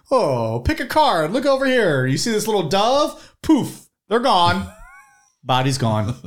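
high, showy magician patter; kids party magician. Pretend. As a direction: hype up the trick like a showman.

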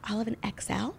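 soft, discreet murmur; kinda quiet. Shown as a demonstration. Shopper discreetly ordering a larger size, said quietly. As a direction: keep it down so nobody overhears. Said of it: "it was like, kinda quiet".

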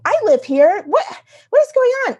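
loud, high exasperated protest; incredulous, high-pitched mock outrage; retold indignant outburst. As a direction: act out road rage, voice shooting up. Indignant outburst at pickup driver, retold.

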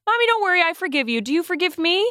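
put-on very high, sweet child voice; little son. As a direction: play the small boy forgiving. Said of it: "He goes".

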